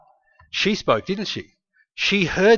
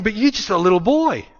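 higher, pleading mock worry; worried king; 7 semitones higher than usual. King protesting to David, high worried voice. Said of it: "And the king's going".

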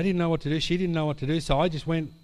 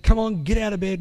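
raised, higher voice ordering her up; firm loud command; 6 semitones higher, 6 dB louder than usual. Retold or imitated. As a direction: rouse her out of bed with a push. Wife hauls the sick friend up.

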